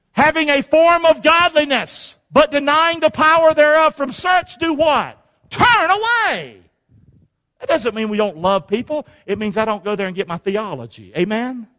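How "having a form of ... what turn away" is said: high, loud scripture reading climbing to a slow "Turn away"; preaching at full force. Acted. Preacher drives the verse home to his congregation, in a high, loud preaching voice. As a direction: thunder the verse from the pulpit.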